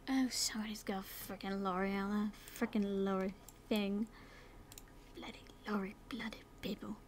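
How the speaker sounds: low grumbling whisper; muttered annoyance. Real, not acted. Annoyed at a lorry outside, low grumbling whisper. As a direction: grumble under your breath at the noise.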